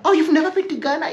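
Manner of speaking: high, fast astonished question; mock disbelief. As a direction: sound amazed she never went. Incredulous imitated questioner.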